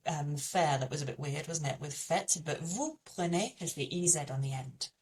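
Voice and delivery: normal talking voice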